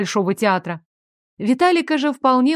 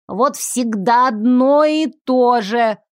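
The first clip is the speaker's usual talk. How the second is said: put-on slow, quavering old lady grumbling; grumpy old woman; slower than usual. A narrator's reading. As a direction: grumble like a cranky granny. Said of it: "сварливо дребезжал старческий голосок". (сварливый старческий голосок)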